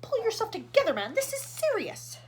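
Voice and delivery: high, loud stern scolding; loud exasperated command; stern snapping. A narrator's reading. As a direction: order him to get a grip. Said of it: "snapped ground control".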